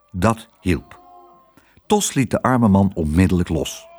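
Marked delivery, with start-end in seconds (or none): none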